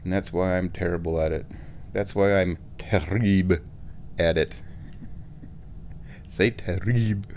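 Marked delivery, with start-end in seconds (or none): 1.9-4.6 "terrible" said the French way, as "tereeb"; French accent. Shown as a demonstration. Vlogger bad at French demonstrates it, French accent on "terrible". 6.3-7.3 playful French "terrible"; French accent with a throaty r in "terrible". Pretend. Vlogger mocking own French, French accent. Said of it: "wanna speak French".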